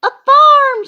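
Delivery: very high, loud squeal; squealy. A narrator's reading. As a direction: squeal it in a friend's ear. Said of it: "real squealy".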